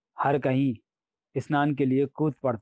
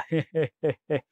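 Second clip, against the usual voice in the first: string of quick he-he giggles; comic, non-scary demon giggle; ticklish laugh. A narrator's reading. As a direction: giggle helplessly like a tickled monster. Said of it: "भयानक ढंग से नहीं हंस रहा".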